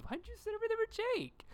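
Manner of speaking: very high, fast squeaky Raven voice; high-pitched impression of a sitcom teen; Raven quote. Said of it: "and Raven goes".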